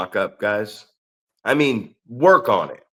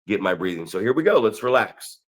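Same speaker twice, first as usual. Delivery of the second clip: usual speaking voice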